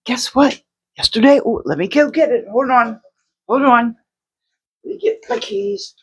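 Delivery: ordinary talk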